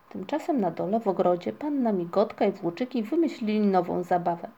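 ordinary delivery, nothing marked

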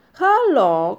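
loud rising call of hello; shouting. A narrator's reading. Moomin papa trapped behind a wall, loud shout. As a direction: shout through the wall for help. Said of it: "krzyczał tatuś Muminka za ścianą".

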